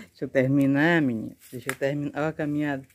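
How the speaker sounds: ordinary talk, nothing marked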